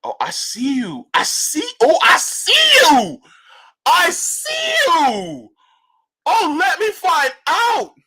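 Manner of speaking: high, loud, slow delighted shout; excited yelling. Real, not acted. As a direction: call it out, thrilled and drawn out. Excited at the brewery.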